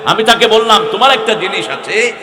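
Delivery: loud, high fervent sermon; preaching at full force. Acted. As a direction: hammer it home to the crowd.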